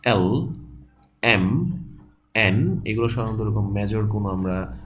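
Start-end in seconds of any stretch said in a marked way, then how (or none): none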